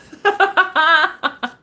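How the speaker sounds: a high, loud burst of laughter; loud delighted laugh. Real, not acted. Laughing at her guess.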